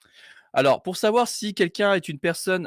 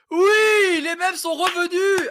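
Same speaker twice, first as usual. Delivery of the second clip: very high, drawn-out cheer; overjoyed celebration; jubilant cry; 13 semitones higher, much slower than usual. Real, not acted. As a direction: shout it in high-pitched triumph. Celebrates the memes working again.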